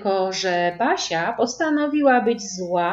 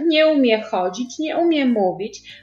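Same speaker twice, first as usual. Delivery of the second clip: put-on grumbling child; 6 semitones higher, 4 dB louder than usual. A narrator's reading. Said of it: "pomyślała Basia".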